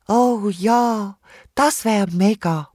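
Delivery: weary, drawn-out kangaroo voice; slow, listless little kangaroo; limp little kangaroo. A narrator's reading. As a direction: say it limply, worn out by the heat. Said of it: "meint das kleine Känguru lahm".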